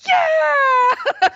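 high joyful shout; overjoyed yell. Retold or imitated. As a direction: cheer it out loud. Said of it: "and I'm like".

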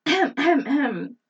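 exaggerated ahem ahem; mock throat clearing. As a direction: clear your throat theatrically. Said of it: "Back on topic".

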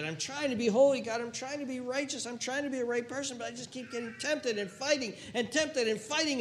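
high, strained plea; desperate pleading. Acted. Tempted believer crying out to God, desperate. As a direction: beg God, worn out from fighting.

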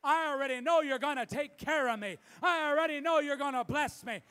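loud, high confident proclamation; full-force preaching. Acted. Pastor preaching to the church, loud and certain. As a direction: preach it out at full volume, certain of God's care.

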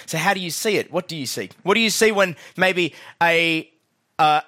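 rally the room from the pulpit; preaching at full force. Acted. Preaching to the church congregation.

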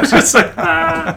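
high put-on cackle in Danny's voice; mock cackle. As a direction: laugh off the joke, old man style. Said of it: "He's like".

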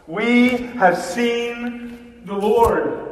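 slow, high, ringing proclamation; joyful cry. Acted. Disciples to Thomas, slow and high in joy. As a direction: announce it in wonder. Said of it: "they cried out".

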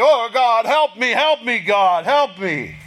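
crying out. Acted. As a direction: cry out to God in desperate prayer. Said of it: "he says it was a cry".

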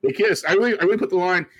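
laughing high and loud at himself. Real, not acted. Laughing at his own line about married people. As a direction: giggle through the admission.

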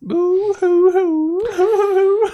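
long drawn-out high wail; fake crying. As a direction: stretch the boo hoo into a wail. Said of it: "it's such convincing crying".

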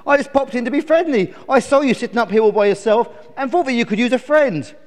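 high, loud chirpy bird; well-meaning storybook bird; friendly sparrow. Acted. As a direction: explain eagerly as a little sparrow.

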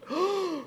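high startled gasp; mock panicked gasp; sharp gasp. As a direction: catch your breath in fright.

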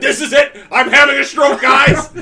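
loud, high mock panic. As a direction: cry out like you're dying from spice. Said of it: "You're like".

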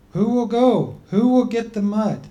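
people's voice. Acted.

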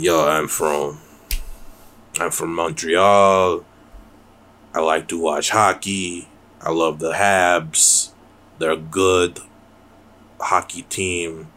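put-on slow, hammy Québécois accent; mock French Canadian, drawn out; fake French Canadian accent. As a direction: badly impersonate a Montreal hockey fan's French accent. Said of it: "talk like dirty hobo Frenchmen".

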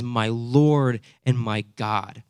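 slow, deliberate declaration; emphatic belief. Acted. Thomas addressing the risen Jesus, slow and emphatic. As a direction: weigh "Lord" and "God" slowly. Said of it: "emphatic belief".

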